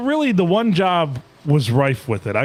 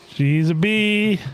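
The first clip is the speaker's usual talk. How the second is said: slow drawn out; 7 semitones higher, much slower than usual. Real, not acted. Slow repeated punchline.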